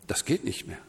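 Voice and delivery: breathless, whispered excuse; tired whisper. Retold or imitated. A retiree sighing to God that he's too old, whispered. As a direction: let the words fall into a whisper.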